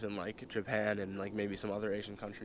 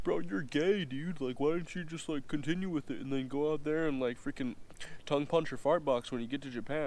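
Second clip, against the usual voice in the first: higher, teasing dude voice mocking the storyteller; a pushy buddy ribbing him, pitched up; mocking bro voice; 5 semitones higher than usual. Imitating a commenter teasing him, higher mocking bro voice. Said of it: "somebody's gonna be like".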